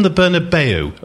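Bernabeu ending in "BAY-oo"; newfangled pronunciation. Retold or imitated. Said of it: "This is what I wanna know".

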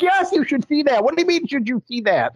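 high, hammed-up shocked reply; mock-outraged high squeal; outraged disbelief. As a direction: play scandalized at the question. Retold outraged reply to trainees.

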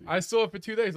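ordinary talk

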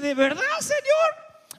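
high, slow disbelieving question; asking God in disbelief, high and slow; incredulous. Acted. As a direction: ask God in disbelief, drawn out. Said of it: "con gente que tú dices".

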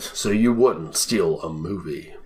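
slow, low epic narration; epic trailer voice. Pretend. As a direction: intone it like a dramatic ad. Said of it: "that epic voice in my throat".

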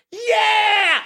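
high-pitched beer-commercial cheer; whooping party yell; rowdy party shout. Acted. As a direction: burst through the door cheering, voice way up. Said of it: "everyone goes".

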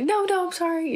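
high-pitched, hurried-to-soothe apology; imitated sheepish reassurance; mock apologetic. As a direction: squeak out a playful apology. Hasty apology to screamed-at co-star.

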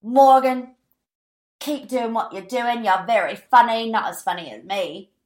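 badly faked British man's accent; P K impression. P K replying to the podcaster's video, horrible British impression. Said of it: "But he was like".

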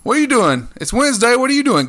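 ordinary talk, nothing marked

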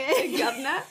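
high, laughing mock cockney accent; cockney greeting. Mocking Ted's bad British accent with a laughing cockney greeting. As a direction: toss out a cheesy British greeting. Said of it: "It's a really bad British accent".

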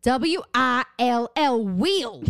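put-on slow Southern-accented spelling; imitated Southern accent. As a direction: drawl out each letter like a Southerner. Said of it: "She is from the South".

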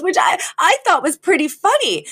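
lifted high, a fussy socialite's retelling; a socialite rehashing a spat, high and theatrical; high dramatic Cece. Retold or imitated. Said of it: "and she goes".

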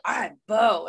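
loud imitation of a bad character voice; mock Bo voice. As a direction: mockingly imitate the narrator's Bo. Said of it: "that is not what Bo sounds".

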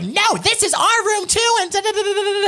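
very high-pitched, hammed-up rant; mock going off. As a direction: imitate an angry outburst in a squealing voice. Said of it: "just goes off on her".

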